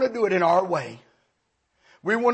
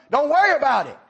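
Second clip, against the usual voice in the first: exasperated; 10 semitones higher, 8 dB louder than usual. Acted. Man snaps at pestering boy.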